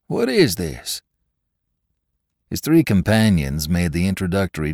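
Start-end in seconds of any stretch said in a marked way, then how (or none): 0.1-1.0 higher, puzzled question; genuinely baffled, asking the room; mystified. Acted. Drummer asks the saloon, mystified and higher.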